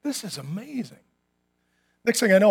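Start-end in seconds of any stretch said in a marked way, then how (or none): 0.0-1.0 awed hush. Retold or imitated. Awed clerk.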